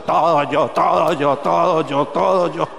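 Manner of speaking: put-on sulky boy muttering his complaint; Chavo del Ocho impression. Preacher mimics Samuel grumbling like el Chavo, lightly voiced. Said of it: "salía así como el Chavo del Ocho".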